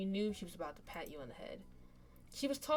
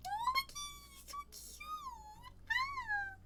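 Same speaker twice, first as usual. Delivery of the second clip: high, slow, gushing squeal of adoration; 5 dB quieter, much slower than usual. Real, not acted. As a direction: melt into a high squeal of delight. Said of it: "I'm sorry, I'm gushing".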